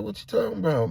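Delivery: high-pitched baffled question; incredulous. Retold or imitated. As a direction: voice jumps up in disbelief. Reacting to shocking news.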